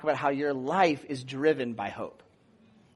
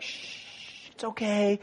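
hushing; 4 semitones higher, 8 dB quieter, much slower than usual. Retold or imitated. Nurse hushing a loud patient.

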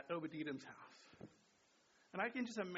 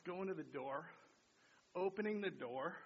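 ordinary talk

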